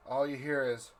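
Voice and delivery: usual speaking voice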